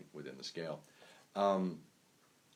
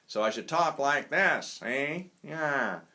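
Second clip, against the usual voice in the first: loud, higher-pitched, slower raised voice; speaking up loudly; 8 semitones higher, 9 dB louder, slower than usual. Shown as a demonstration. Musician showing listeners a volume to match the sax, speaking up loudly. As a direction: project over a saxophone. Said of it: "I need to speak louder".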